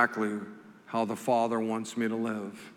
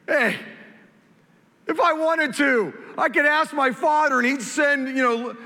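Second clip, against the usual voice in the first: loud, higher voice, driving the point home; emphatic retold declaration; 11 semitones higher, 4 dB louder than usual. Preacher voicing Jesus to the congregation, loud and emphatic. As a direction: proclaim it with full preacher force. Jesus paraphrased at his arrest.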